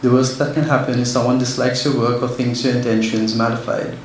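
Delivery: usual speaking voice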